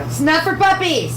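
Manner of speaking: high, slow sing-song scolding of a dog; puppy talk. Real, not acted. Owner telling her puppy off in a high sing-song.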